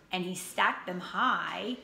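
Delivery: ordinary delivery, nothing marked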